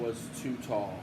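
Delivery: plain reading voice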